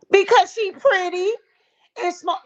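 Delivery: high-pitched mocking mimicry; mocking imitation. Host mocking a rival's answer about [name], mocking imitation. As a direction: mimic her in a high, loud jeering voice. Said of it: "you said because she was pretty and small".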